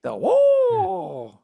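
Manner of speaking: high, loud Bruce Lee battle cry; Bruce Lee whoa. Retold or imitated. Imitating Bruce Lee's cry for the show's sound effects. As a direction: let out a soaring kung fu wail. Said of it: "the Bruce Lee sound effects".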